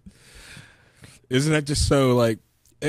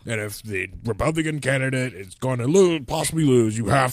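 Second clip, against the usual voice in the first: lightly voiced grumbling old boomer; 4 semitones higher, 3 dB louder than usual. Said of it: "I like your boomer voice".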